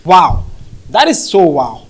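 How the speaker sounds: normal talking voice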